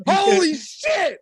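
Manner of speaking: high, drawn-out holy shit; shocked boss. Retold or imitated. Imagined boss reacting to a bleeding worker, drawn-out shock. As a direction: stretch it out in mock horror.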